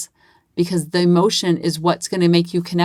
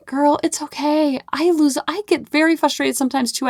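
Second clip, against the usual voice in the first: reassuring mom; 9 semitones higher than usual. Retold or imitated. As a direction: soothe a sorry little girl. Mom reassuring upset daughter.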